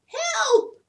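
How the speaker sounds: high squeaky cry for help; a tiny frightened squeak. Acted. Peacock squeaking for help, high and loud. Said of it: "squeaked the peacock".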